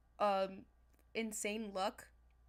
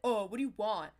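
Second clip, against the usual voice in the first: annoyed brush-off, louder, in a put-on voice; rude Jake; 7 dB louder than usual. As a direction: brush off an unwanted friend. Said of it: "And he's like".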